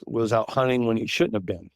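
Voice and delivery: usual speaking voice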